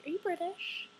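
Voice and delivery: high-pitched, incredulous question; mock American questioner. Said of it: "they'll just be like".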